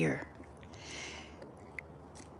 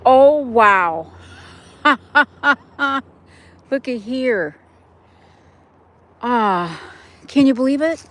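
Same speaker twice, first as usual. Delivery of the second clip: amazed; 6 semitones higher, 7 dB louder than usual. Real, not acted. Amazed at chicken damage.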